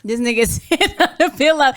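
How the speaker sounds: high, loud, laughing jab; amused outburst broken by laughter; laughing tease. Real, not acted. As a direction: crack up while calling it out. Co-host laughs at the host.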